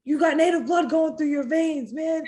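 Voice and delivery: high, loud pep talk, imitating the coach; hyped-up cornerman. Coach firing up his tired fighter between rounds, high loud pep talk. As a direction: pump her up like a fired-up cornerman. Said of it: "He's like".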